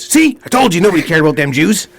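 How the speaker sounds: loud, high gleeful punchline; hammed-up triumphant dictator; Hitler punchline. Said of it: "And Hitler says".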